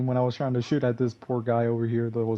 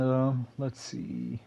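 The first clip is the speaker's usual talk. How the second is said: ordinary delivery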